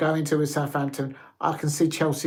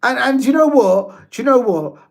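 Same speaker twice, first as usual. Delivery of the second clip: louder and higher, grabbing attention; excited outburst; 7 semitones higher, 7 dB louder than usual. Real, not acted. To viewers, as the score turns, excited outburst. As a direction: burst back in with a big reveal. Bursts out after long pause.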